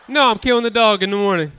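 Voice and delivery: fast and loud at the heckler; loud snap back. Real, not acted. Comic answering a heckler, fast and loud. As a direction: fire it right back.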